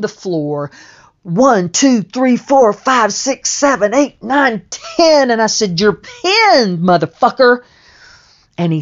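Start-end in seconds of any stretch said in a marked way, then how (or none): 1.2-5.3 higher and louder with each number, hammering the count; shouted pin count. Retold or imitated. Slamming the floor to count out the pinned man, shouting. 5.8-7.6 high, loud victory yell; triumphant shout. Retold or imitated. Gloating over the pinned opponent, loud triumphant yell. Triumphant after winning the pin.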